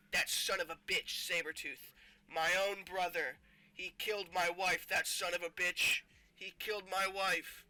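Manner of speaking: hammed-up vengeful hero; acted outrage. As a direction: rage about the brother who betrayed you. Acted outrage at brother's murder.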